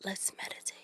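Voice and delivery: hushed, airy zen impersonation; very soft, breath-only voice; mock-meditative whisper. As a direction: whisper it like a serene guru. Impersonating singer's meditative manner.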